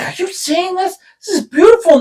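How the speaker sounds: high-pitched mock wonder; imitated amazement. Imitates companion's amazed exclamation.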